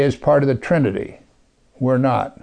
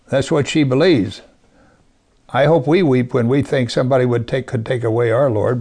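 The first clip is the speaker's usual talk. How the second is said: ordinary talk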